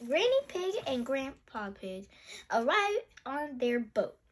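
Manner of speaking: usual speaking voice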